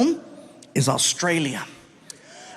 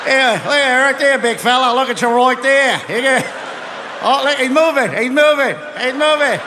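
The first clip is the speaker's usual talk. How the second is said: jeering beachgoer; 6 semitones higher than usual. Retold or imitated.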